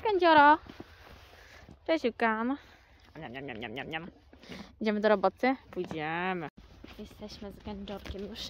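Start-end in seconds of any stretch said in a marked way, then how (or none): 3.2-4.1 soft, low munching sounds; munching noises. Pretend. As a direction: make quiet chewing noises for the horse. Imitating horse eating. (ciche mlaskanie)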